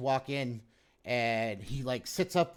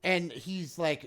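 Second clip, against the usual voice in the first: ordinary talk, nothing marked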